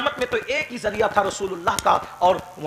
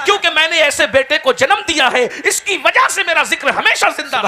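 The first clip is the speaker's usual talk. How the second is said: proud cry; 9 semitones higher, 7 dB louder than usual. Retold or imitated. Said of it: "لیکن کہتی ہے".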